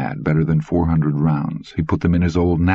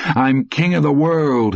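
mighty shout; 10 semitones higher, slower than usual. Acted. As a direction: shout it at the sky. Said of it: "he shouted mightily at the high".

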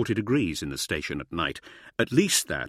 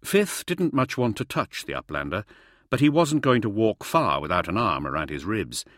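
ordinary delivery

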